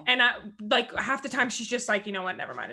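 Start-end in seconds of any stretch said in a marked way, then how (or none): 2.1-2.7 imitated giving up. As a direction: drop the voice and wave it off.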